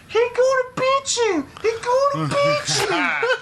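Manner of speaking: very high, slow mock taunt; squeaky taunting sing-song; high teasing background voice; mimicking a voice in the background. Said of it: "you hear in the background".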